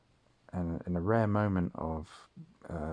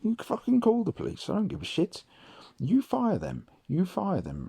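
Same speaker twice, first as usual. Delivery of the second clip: higher, louder imitation of a raging dad; retold rage; 13 semitones higher, 5 dB louder than usual. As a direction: act out dad's furious defiance. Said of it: "and he fucking raged".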